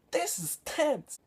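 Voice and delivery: very high, slow, strained squeak; mock hammed-up movie line; imitating the actor's delivery. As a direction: stretch it out in a high, strangled overacted voice. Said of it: "Do you wanna hear the way he said the words".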